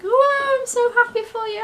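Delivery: high, loud gush that eases down; delighted reaction. Retold or imitated. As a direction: imitate a thrilled friend. Friend's delighted reaction retold.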